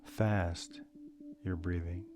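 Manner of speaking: calm, measured meditation guide with long pauses; unhurried breathing guidance, leaving space after each phrase; guided meditation. Real, not acted. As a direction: lead listeners gently through a breathing meditation.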